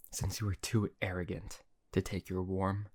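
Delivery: ordinary delivery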